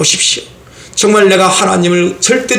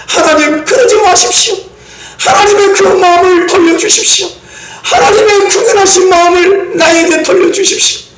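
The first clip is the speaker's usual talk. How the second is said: tearful pleading prayer; 10 semitones higher than usual. Acted. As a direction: beg God for mercy through tears. Voices pleading repentance prayer. (울부짖는 회개 기도)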